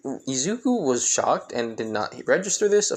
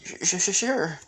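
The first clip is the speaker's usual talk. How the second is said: higher, nervous stammer; stuttering; 5 semitones higher than usual. Acted. Hinata agrees to go now with Naruto, stuttering. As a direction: stick on the first sound before agreeing. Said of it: "like, you know, stuttering".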